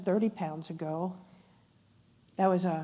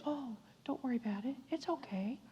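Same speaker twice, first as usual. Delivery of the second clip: soft, higher comforting voice; hushed soothing of someone upset; gentle reassurance; 5 semitones higher, 10 dB quieter than usual. Retold or imitated. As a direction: reassure softly, almost whispering. Waitress comforts the crying speaker.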